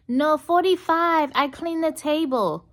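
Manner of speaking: high, stubborn waitress, slow; insistent waitress. Retold or imitated. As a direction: dig in on the amount. Waitress insisting on her tip.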